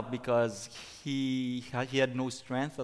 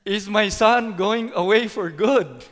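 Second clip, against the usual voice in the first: high, loud, emotional outburst; alarmed father's anguished question, pitched high; scared; 8 semitones higher, 11 dB louder than usual. Retold or imitated. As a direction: raise the voice high and loud in fear. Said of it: "actually scared me".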